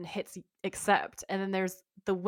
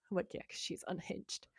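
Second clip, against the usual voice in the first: whispered aside; 2 semitones lower, 12 dB quieter than usual. Real, not acted. Muttered aside.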